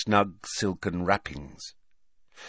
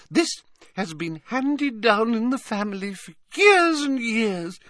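put-on doting old lady; 14 semitones higher, 3 dB louder than usual. A narrator's reading. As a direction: fuss over him like a grandmother. Old witch boasting of cup.

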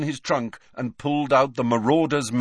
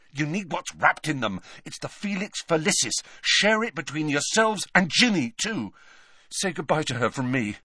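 higher, earnest urging; hurried Harry; 6 semitones higher than usual. A narrator's reading. Boy handing friends the lucky potion, earnest and urgent. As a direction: press the gift on them.

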